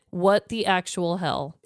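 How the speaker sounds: ordinary delivery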